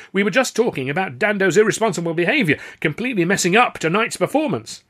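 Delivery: loud, high, indignant bluster. A narrator's reading. Star telling on a colleague to the manager, blustering. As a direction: bluster loudly to shift the blame. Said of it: "breaking the stunned silence with bluster".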